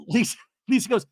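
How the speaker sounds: ordinary talk, nothing marked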